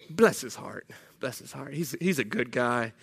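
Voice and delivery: low, soft, pitying aside; quiet, hesitant faint praise, acted; condescending sympathy. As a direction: drop low and soft, feigning kindness. Judaizers gently undermining Paul.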